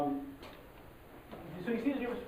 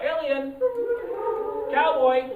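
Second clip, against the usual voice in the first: high loud cues; called out loud; 9 semitones higher, 7 dB louder, slower than usual. Shown as a demonstration. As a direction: shout cues to the crowd. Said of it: "we're gonna do this all together".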